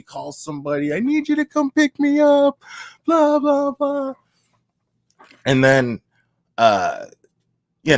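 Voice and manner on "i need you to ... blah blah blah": high, wailing imitation of her call; whiny tearful plea, pitched way up; mock tearful caller. Imitating her phone call, crying.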